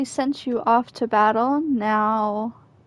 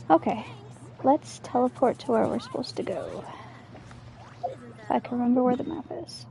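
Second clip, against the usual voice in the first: ordinary delivery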